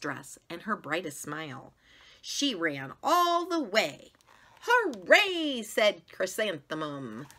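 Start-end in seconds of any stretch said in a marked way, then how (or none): 4.6-5.6 high, loud gleeful hooray; excited cheer. Acted. Girl running to her first school day, gleeful cheer. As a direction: burst out with joy.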